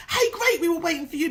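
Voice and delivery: high, bright hammed-up greeting from the neighbours; mock cheery welcome. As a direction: play the overjoyed neighbour, pitched well up.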